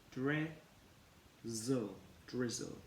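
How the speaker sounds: normal talking voice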